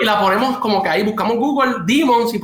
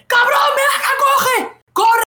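very high mock-panicked calls; imitated yelling; slower than usual. Imitating spooked friend summoning the others, high-pitched yelling. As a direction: yelp it urgently, imitating a scared friend. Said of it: "empieza a gritar".

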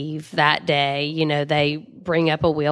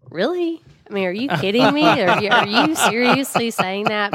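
incredulous retort; 6 semitones higher than usual. Retold or imitated. Retorting to a tactless remark.

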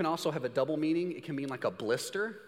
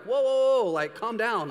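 high, loud startled whoas settling down; 6 semitones higher, 5 dB louder than usual. Retold or imitated. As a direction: rein someone in, alarmed at first. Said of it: "You're like".

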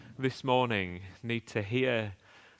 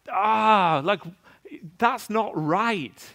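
indignant outburst; 8 semitones higher, 4 dB louder, slower than usual. Pretend. Voicing listener's outraged reaction.

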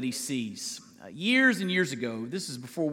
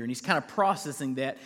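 usual speaking voice

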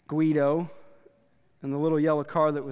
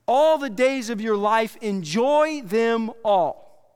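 loud, high, slow proclamation; preacher declaring a verse; proclaiming scripture; 6 semitones higher, 6 dB louder, slower than usual. Retold or imitated. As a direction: boom the command out slowly. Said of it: "is what Solomon said".

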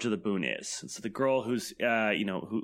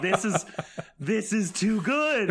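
laughing with delight; 9 semitones higher, 3 dB louder, slower than usual. Retold or imitated. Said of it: "and I'm like".

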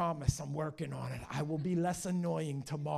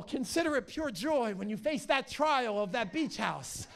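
usual speaking voice

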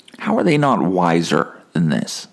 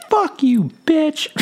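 put-on much higher tiny child's voice; little kid; much slower than usual. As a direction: squeak it like a small kid swearing. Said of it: "this little kid's like".

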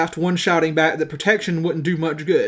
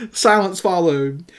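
ordinary talk

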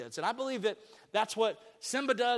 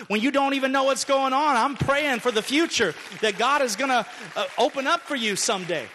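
louder and louder blessing, rising; impassioned preaching; 5 semitones higher, 7 dB louder than usual. Acted. Pastor voicing a dad's blessing, building to a loud preach. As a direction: pour it out at full preaching force.

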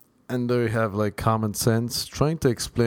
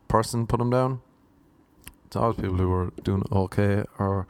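ordinary delivery

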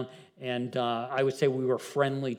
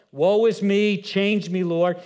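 mock lament; 6 semitones higher, 7 dB louder, slower than usual.

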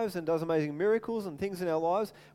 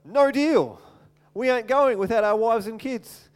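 bold refusal; 3 semitones higher, 6 dB louder than usual. Retold or imitated. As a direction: stand your ground against the king. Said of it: "Moses says to Pharaoh".

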